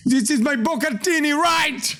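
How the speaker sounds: a light mock Italian-American accent; mild fake Italian accent; imitating Italian chef. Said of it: "J'ai perdu mon accent italien".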